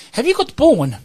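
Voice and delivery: customer asking. Retold or imitated. Woman at the counter asking the clerk, high. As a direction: ask it high and earnestly. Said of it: "she said".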